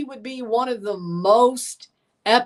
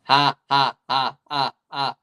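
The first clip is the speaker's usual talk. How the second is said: mock laugh; 9 semitones lower than usual. Mock laughter at rival fans.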